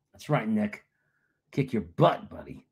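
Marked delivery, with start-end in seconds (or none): none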